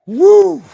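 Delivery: high, loud celebratory whoop. Real, not acted. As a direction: let out a big woo. Whoops at own story.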